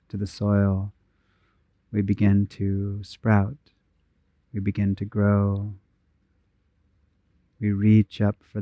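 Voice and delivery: unhurried, even meditation voice with long pauses; guided meditation. Real, not acted. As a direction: guide them gently inward, phrase by phrase, leaving space to breathe.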